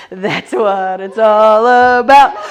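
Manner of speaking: big shouted sing-along finish; belted finale. Pretend. Instructor belting the song's end to kids, shouted and slow. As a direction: belt the song's last line out loud and slow.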